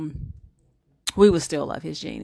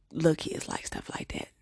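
whispered aside; 5 semitones lower, 8 dB quieter than usual. Real, not acted.

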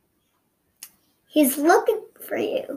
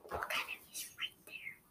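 tense hushed whisper; whispering while hiding; 23 dB quieter than usual. Real, not acted. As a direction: whisper it urgently. Hiding from enemy in game.

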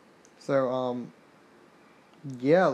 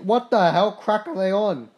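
1 stunned disbelief; 5 semitones higher, 5 dB louder than usual. Real, not acted. Shocked at rival team's record.

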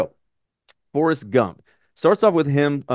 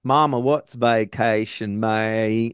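slow mock Southern drawl, words chewed; imitated slow-witted Alabama boy; Forrest Gump; slower than usual. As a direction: chew on each word and let it fall out of the mouth. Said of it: "chewing on those words and they're just falling out of his mouth".

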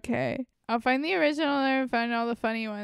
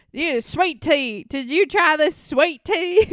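slow, higher mock Southern drawl; Southern accent; 5 semitones higher, 6 dB louder than usual. Friend mocks Southern TikToks in a slow, higher Southern drawl. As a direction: ham up a Southern belle asking about sweet tea. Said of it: "all the things that I saw".

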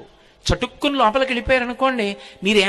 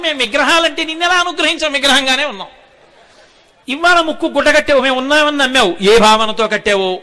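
higher and louder, the gods proclaiming; booming divine voice; 4 semitones higher, 7 dB louder than usual. Acted. Vighneshwara and Saraswati answer the student, loud divine proclamation. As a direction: speak as deities rebuking, then blessing.

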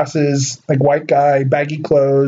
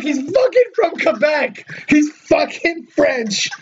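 amazed disbelief; 10 semitones higher, slower than usual. Retold or imitated. Own amused reaction retold.

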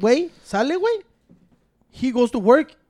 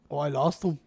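meek Ciro voice; 8 semitones lower, 8 dB quieter than usual. Retold or imitated. Imitating Ciro answering about keys.

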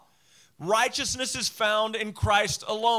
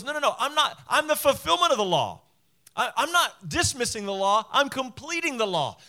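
raised, louder insistence as Jesus; emphatic protest; 6 semitones higher, 4 dB louder than usual. Retold or imitated. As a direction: correct them urgently, pitched up high. Voicing Jesus correcting fearful listeners.

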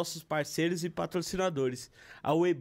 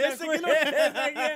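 laughing; 8 semitones higher, 5 dB louder, faster than usual. Real, not acted. Teasing about Cleito laughing.

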